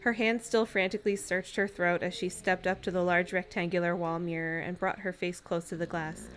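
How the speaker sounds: ordinary talk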